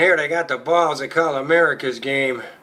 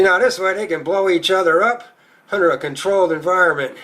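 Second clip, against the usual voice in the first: normal talking voice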